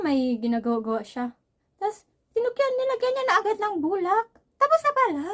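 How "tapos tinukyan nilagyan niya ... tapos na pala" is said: higher and louder, building amazement; excited retelling. Real, not acted. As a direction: pitch up as the surprise sinks in. Amazed extraction was already over.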